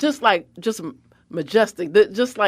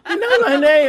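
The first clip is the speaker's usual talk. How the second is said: giddy laugh; 7 semitones higher, 6 dB louder than usual. Retold or imitated. Starstruck guest laughing that the president knows her name. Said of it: "And I was".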